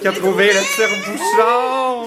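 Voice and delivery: high, stretched-out party cheer; real celebratory announcement. Real, not acted. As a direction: proclaim it triumphantly, drawing out the words. Announces corkscrew finder at party. (annonce triomphale et festive, voix aiguë)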